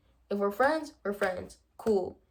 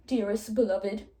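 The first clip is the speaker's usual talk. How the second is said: mock formal reading; 3 dB louder than usual. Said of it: "oh, almost".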